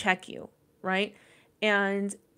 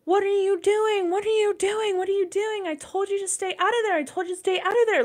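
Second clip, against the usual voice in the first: put-on high-pitched, frantic reenactment of panicking at a child; retold panic; 11 semitones higher than usual. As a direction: repeat it in a rising panic, much higher than usual. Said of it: "I was panicked. I started crying".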